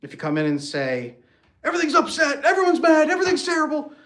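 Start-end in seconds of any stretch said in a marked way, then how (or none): 1.6-3.9 much higher, louder, whiny upset wife; hammed-up overwhelmed wife; distraught wife. Wife complaining vaguely to husband, mock distraught high voice.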